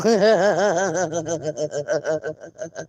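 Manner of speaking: long helpless giggle; real giggling fit. Real, not acted. Reading a funny chat message, long giggle. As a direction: let the laugh roll on.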